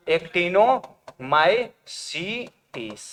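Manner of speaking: actinomycetes very slowly, broken into separate parts; syllable-by-syllable dictation. Shown as a demonstration. Teacher repeating actinomycetes for students, slowly syllable by syllable. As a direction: spell out actinomycetes by sound, pausing between chunks. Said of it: "कौन बोला था?".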